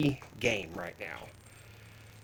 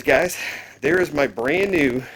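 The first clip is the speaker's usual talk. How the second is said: normal talking voice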